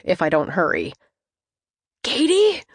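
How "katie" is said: raspy. Acted. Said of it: "a voice rasps".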